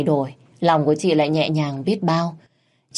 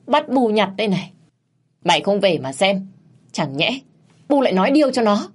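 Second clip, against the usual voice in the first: yelling; 4 semitones higher than usual. Acted. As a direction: scream the accusations, rising to a shriek. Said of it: "vẫn gào mồm lên".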